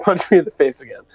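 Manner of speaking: ordinary delivery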